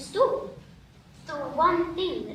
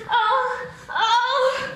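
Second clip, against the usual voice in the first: rising laughing oh's, higher each time; 7 semitones higher, 3 dB louder, much slower than usual. Acted. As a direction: laugh it out, climbing up. [name] breaks into laughter.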